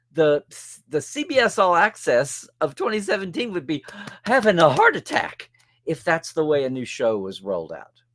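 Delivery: ordinary delivery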